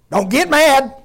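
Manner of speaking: hammed-up motherly yell; mock mama yelling. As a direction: bark it like a mother scolding a kid. Mimicking mama's command, louder.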